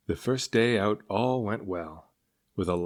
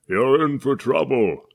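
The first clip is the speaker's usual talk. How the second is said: put-on loud, slow, booming old-man warning; old man bellows at the departing ship; bellowing old man; 5 semitones higher, 8 dB louder than usual. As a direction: thunder a doom-laden curse. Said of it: "he bellowed".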